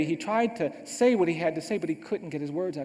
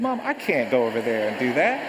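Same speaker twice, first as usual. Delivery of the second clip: loud, low-pitched plea to mom; imitating a boy's loud objection; protesting child; 4 semitones lower, 7 dB louder than usual. Boy balks at his mother's order, protesting.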